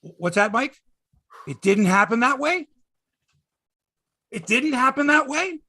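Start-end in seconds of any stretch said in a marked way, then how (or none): 1.5-5.6 incredulous question climbing higher on the repeat; mock disbelief. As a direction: feign shock at the bad news. Mock disbelief at imagined news.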